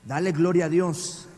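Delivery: normal talking voice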